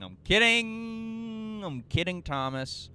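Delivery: playful teasing. Real, not acted.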